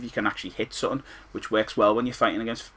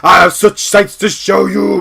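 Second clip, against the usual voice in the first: slow, loud, looming villain line; creepy demon voice, drawn out and loud; slow menacing Pinhead; 8 semitones higher, 12 dB louder, slower than usual. Retold or imitated. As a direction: menace him slowly like Pinhead. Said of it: "he goes".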